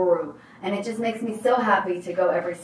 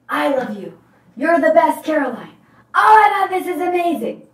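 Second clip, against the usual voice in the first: higher, louder gushing yells; imitated screaming; 8 semitones higher, 7 dB louder than usual. Man yelling praise at the spin instructor, mock screaming. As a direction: cheer like you cannot hold it in. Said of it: "[name] screaming bloody murder".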